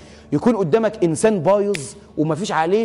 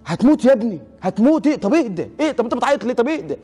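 higher-pitched, worried pleading to calm down; retold attempt to settle someone crying hard; alarmed soothing; 6 semitones higher than usual. Preacher to sobbing friend he fears will die, alarmed soothing. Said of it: "لغاية إن أنا قعدت أطبطب عليه".

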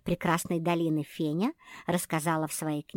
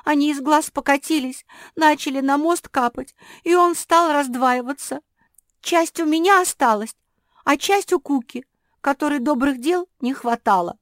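high, loud plaintive wail; tearful pity; 10 semitones higher, 10 dB louder than usual. A narrator's reading. Жозефина recalling her pity for Куки, tearful. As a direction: lament as if near tears. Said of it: "шмыгнула носом Жозефина".

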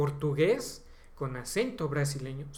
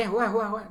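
higher and louder fake jua jua laugh; mock cartoon laugh; 6 semitones higher, 5 dB louder than usual. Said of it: "El acento mexicano no es así". (risa burlona de caricatura, más aguda y fuerte)

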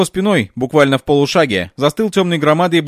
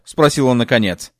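usual speaking voice

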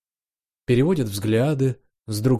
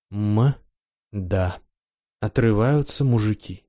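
usual speaking voice

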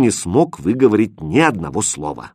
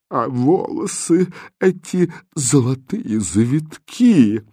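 slow, raised, swooning delight; rapturous; 4 semitones higher, slower than usual. A narrator's reading. Third lady gushes over his golden curls, rapturously. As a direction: draw out the admiration in a higher voice. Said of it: "восторженно вскрикнула третья".

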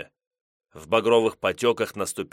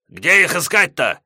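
shouting; 8 semitones higher, 8 dB louder than usual. Acted. Gos shouting.

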